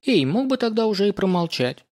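mock-offended, pitched high; light, teasing outrage; playful indignation. A narrator's reading. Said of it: "шутливо возмутилась она".

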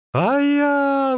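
high, drawn-out cry; exclaiming. A narrator's reading. Exasperated runner answers the magician, exclaiming. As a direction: wail it out long and loud. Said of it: "воскликнул Ликуй".

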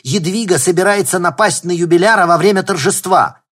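loud, high alarmed shout; urgent shout. Acted. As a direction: shout the warning in a panic. Urgent phone warning.

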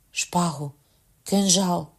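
ordinary delivery

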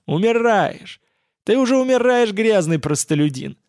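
high, gloating taunt; predatory gloat. A narrator's reading. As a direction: sneer it down at the dying man. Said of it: "бросил Васильев хищно".

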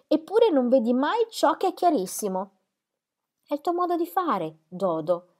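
Celia scolding. A narrator's reading. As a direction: tell her off, pitched high.

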